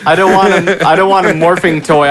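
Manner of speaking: ordinary talk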